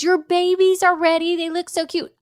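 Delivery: high, cooing pet-lady voice; sweet groomer voice. Retold or imitated. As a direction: coo it like a doting groomer. Groomer's message to dog owner.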